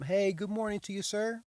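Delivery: chipper teller greeting. Retold or imitated. Bank teller greets a walk-in customer, chirpy and high.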